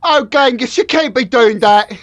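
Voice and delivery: high-pitched hammed-up Mongolian accent; bad Mongolian accent. Imitating Mongolians from the Genghis Khan movie, bad Mongolian accent. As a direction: put on a comically wrong Mongolian accent. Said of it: "This is my Mongolian accent".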